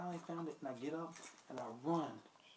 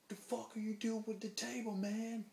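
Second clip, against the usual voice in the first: high, indignant mock challenge; raised-voice impression of an outraged bouncer; retold angry bouncer; 9 semitones higher, 4 dB louder than usual. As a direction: imitate a bouncer confronting a thief, pitched up. Bouncer confronts table thief, retold.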